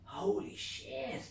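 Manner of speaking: slow, breathy then high holy shit; drawn out in disbelief; awestruck. Retold or imitated. Man looking at the moon, slow stunned awe. First sight of moon craters.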